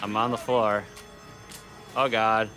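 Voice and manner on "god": ordinary talk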